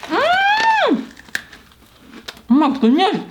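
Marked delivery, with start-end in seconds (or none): 0.1-1.0 high squeal of yum; delighted high mm. Real, not acted. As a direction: squeal mmm at the taste. Tasting freeze-dried mango.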